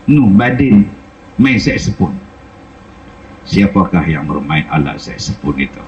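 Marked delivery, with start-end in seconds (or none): 3.5-5.8 lower, softer voice of a grieving blind man; blind Kasim's voice. Retold or imitated. Blind Kasim asking the landlady who is crying, low and soft. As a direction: speak low and gentle, asking who weeps.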